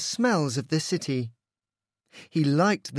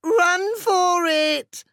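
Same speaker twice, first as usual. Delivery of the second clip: panicked yell; 14 semitones higher, 5 dB louder, slower than usual. A narrator's reading. Pooch warns friends.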